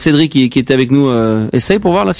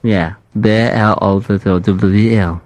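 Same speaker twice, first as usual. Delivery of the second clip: lower, slow broken English; prank caller; 6 semitones lower, much slower than usual. Pretend. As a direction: spell the fake name out clumsily and slowly. Prank caller spells fake name.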